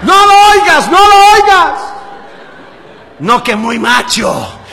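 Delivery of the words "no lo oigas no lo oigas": loud, high imitated begging; hammed-up desperate cry; frantic pleading. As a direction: plead at the top of the voice, mimicking panic. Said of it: "Él decía".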